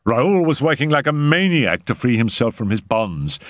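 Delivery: ordinary delivery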